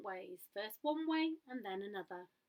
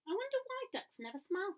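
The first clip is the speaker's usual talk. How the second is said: musing to himself; 10 semitones higher than usual. A narrator's reading. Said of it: "thought Gumboot to himself".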